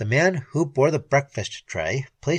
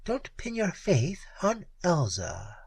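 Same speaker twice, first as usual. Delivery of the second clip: narrow-eyed warning; 5 semitones higher, 6 dB quieter than usual. A narrator's reading. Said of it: "with a narrowing of her green eyes".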